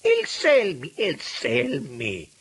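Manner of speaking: high, loud, dragged-out roar; furious roar. A narrator's reading. Minister wants Selmi in his clutches, roaring. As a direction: roar the name, stretching it. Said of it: "ruggì Francesco Datri".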